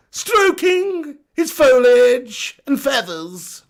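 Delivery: very slow, drawn-out documentary narrator; high, loud, hammed-up nature documentary voice; Attenborough. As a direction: narrate wildlife with grand, stretched words. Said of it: "Attenborough or Clarkson?".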